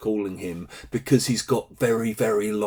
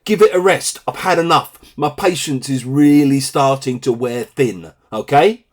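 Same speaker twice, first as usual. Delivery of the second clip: re-enacted telling-off, raised in pitch and volume; exasperated parent; 3 semitones higher, 4 dB louder than usual. Parent to bickering kids, exasperated final warning. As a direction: scold them as a last warning. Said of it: "I sometimes say".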